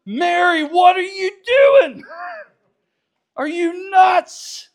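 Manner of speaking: high, slow, shouted disbelief; shocked shout. Retold or imitated. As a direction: yell it at her in shock. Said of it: "and they're like".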